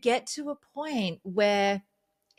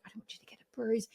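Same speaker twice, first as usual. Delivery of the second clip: fast, gentle whispered reassurance; soft whisper; 4 semitones higher, 6 dB quieter, faster than usual. Retold or imitated. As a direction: whisper it tenderly to someone on the floor. Marina soothing her on floor.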